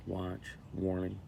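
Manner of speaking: ordinary talk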